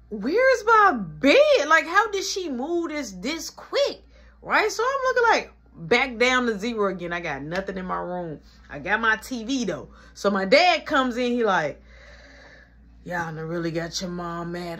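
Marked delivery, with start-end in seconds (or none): 0.1-4.0 stunned disbelief. Retold or imitated. Shocked at emptied room. 13.0-14.8 weary dad. Retold or imitated. Dad voiced in story.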